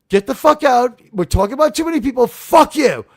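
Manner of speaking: yelled out high and loud, furious; furious Archer. Retold or imitated. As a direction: blow up at the doctor. Archer quote, furious outburst.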